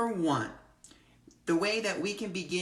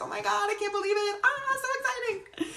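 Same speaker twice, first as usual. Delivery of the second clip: high, fast, laughing squeal; thrilled high giggly gush; giddy excitement. Retold or imitated. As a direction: gush like you just got the invite. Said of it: "I was like".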